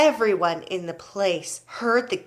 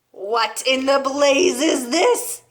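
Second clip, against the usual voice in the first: slow, loud, higher-pitched outrage; yelling; 4 semitones higher, 5 dB louder, slower than usual. A narrator's reading. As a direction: demand it in a drawn-out yell. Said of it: "yelled the Trunchbull".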